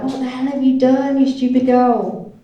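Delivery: loud, high scolding sister; angry sister. Retold or imitated. Sister scolding her for bringing the partner home, angry. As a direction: berate her like a furious sister. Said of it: "my sister was like".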